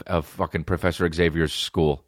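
ordinary delivery

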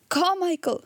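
high-pitched, restrained call; crying out. A narrator's reading. Indian gentleman summoning Carmichael, crying out. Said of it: "cried out loudly".

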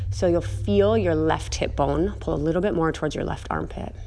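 soft, unhurried cool-down guidance; calm yoga voice. Acted. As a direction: ease the class down gently, softly.

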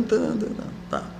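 usual speaking voice

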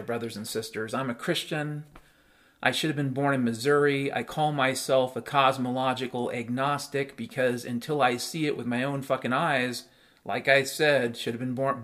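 usual speaking voice

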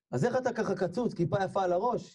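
high, incredulous string of questions; amazed questioning. Retold or imitated. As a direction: marvel at a total transformation. Children amazed at the changed man. (תדהמה וחוסר אמון)

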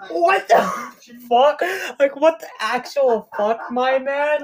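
high-pitched, hammed-up bewilderment; exasperated imitation, broken by pauses; mock disbelief. Marty reacts to Doc alive.

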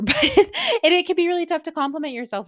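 high, loud, through laughter; amused at own slip, voice riding high; laughing while talking. Real, not acted. Laughing at own accidental accent slip.

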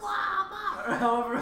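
slow, squawky cartoon duck; Donald Duck voice. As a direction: quack out the words like a cartoon duck. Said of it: "That was really good".

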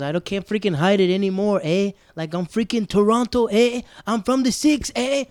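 loud, high hammed-up Toronto impression; friend's Toronto slang. Imitating a friend who can't hide Toronto, loud and high. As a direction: mimic a friend proudly blurting Toronto slang. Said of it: "she talked like a New Yorker".

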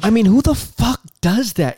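incredulous high outburst; outraged. Real, not acted. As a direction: blurt it out in disbelief. Outraged podcast host ranting.

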